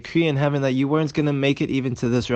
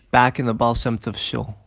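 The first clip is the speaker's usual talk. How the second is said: ordinary delivery, nothing marked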